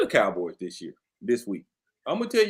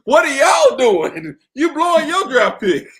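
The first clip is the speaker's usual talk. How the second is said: much higher, louder disbelieving cry; mock outrage; 11 semitones higher, 8 dB louder than usual. Real, not acted. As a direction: holler it half laughing at them. Mocking team tanking, laughing.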